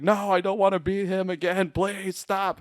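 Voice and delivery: high-pitched mock-panicked protest; pleading. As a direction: beg them to stop, voice pushed up. Voiced Banner pleading not to go.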